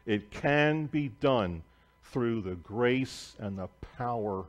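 usual speaking voice

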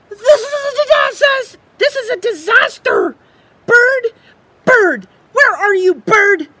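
high, loud, near-crying squirrel panic; frantic squirrel. Acted. Squirrel cries out to Bird at the disaster, frantic. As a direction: wail it like the sky is falling.

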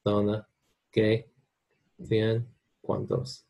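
Castilian Spanish, c and z in "cena, zona" said as th; lisped Castilian th in "cena" and "cien", word by word; Spain accent. Shown as a demonstration. Teacher shows students the word list in a Spain accent. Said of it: "But in Spain accent, it's gonna be".

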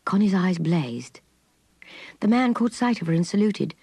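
plain reading voice

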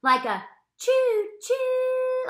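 "choo choo" high, loud and slow like a train whistle; choo choo sound. Shown as a demonstration. Teacher making train sounds for kids, high and slow.

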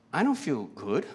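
usual speaking voice

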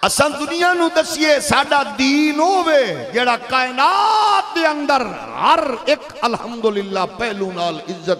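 high, drawn-out sermon shout; shouted preaching. Real, not acted. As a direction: cry it out slowly at full force to the crowd.

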